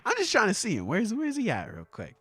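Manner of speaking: bashful mumbled fishing for a crush; cutesy. Acted. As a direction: ask quietly, fumbling to sound casual. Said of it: "First you have Rocks acting all cutesy".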